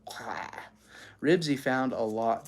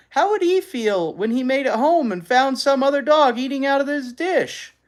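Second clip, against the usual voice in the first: high, loud, desperate pleading; pleading boy; 11 semitones higher, 8 dB louder than usual. A narrator's reading. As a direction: beg like a boy who misses his dog. Henry pleading for his dog.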